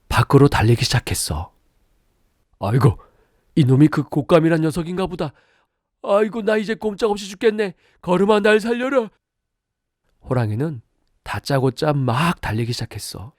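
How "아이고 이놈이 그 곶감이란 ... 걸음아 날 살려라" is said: high, fast terrified tiger; panicked tiger. Acted. Tiger fleeing the thing on its back, panicked high fast voice. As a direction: babble in panic while running.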